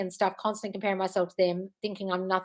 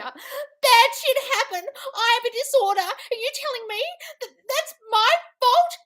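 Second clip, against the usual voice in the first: much higher, slow whiny parody; mocking victim voice; 13 semitones higher, slower than usual. Host parodying a commenter blaming her, high whiny mockery. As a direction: whine it like a wounded victim.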